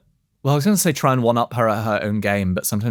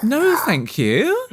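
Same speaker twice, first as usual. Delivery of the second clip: high drawn-out no thank you; mock horror; 13 semitones higher, much slower than usual. Real, not acted. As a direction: recoil in comic disgust. Recoiling from image of dad.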